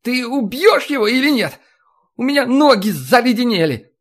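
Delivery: high, shrill hysterical shout; near-hysterical complaining yell. A narrator's reading. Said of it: "истеричные нотки".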